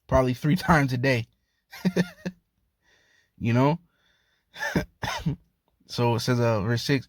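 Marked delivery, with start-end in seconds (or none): 1.7-2.3 short squeaky giggle; amused high-pitched laugh. Real, not acted. Laughing at own remark. 4.6-5.4 very high, soft squealing laugh; giggling squeal; squeal of laughter. Real, not acted. Laughing at own remark.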